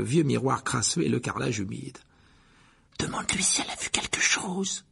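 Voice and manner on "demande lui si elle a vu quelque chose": soundless whisper, lips barely moving; silent mouthing. A narrator's reading. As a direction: mouth the words without voice. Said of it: "en remuant les lèvres silencieusement". (chuchotement muet)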